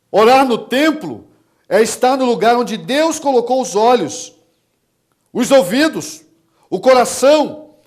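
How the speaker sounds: high, loud proclamation in bursts; full-force preaching. Real, not acted. Preacher urging congregation to pray in temple, impassioned preaching. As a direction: proclaim each phrase forcefully.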